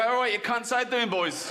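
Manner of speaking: lightly put-on working-class London chat; mild matey geezer voice for the scaffolders; working-class builder voice. Shown as a demonstration. Greeting builders on site in a matey working-class voice. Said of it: "Like that".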